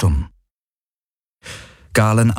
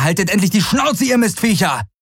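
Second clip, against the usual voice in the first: angry yell; 13 semitones higher than usual. Acted. Galen cursing the birds overhead, yelling. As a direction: yell at the birds, pitched much higher.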